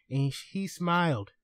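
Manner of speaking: normal talking voice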